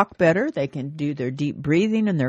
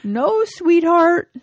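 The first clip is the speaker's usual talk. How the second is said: very high, slow falsetto wife; high slow mock-wife reply; old wife voice; 12 semitones higher, 6 dB louder, much slower than usual. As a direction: pipe it up sweetly like the wife. Wife Esther answers in joke.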